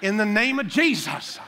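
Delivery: loud, high mock-fervent declaration; hammed-up prayer cry. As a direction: proclaim it like a showy prayer. Mock showy prayer over the needy.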